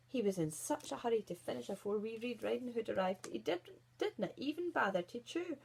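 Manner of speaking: ordinary delivery, nothing marked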